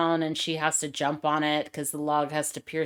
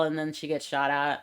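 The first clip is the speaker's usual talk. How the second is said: ordinary talk, nothing marked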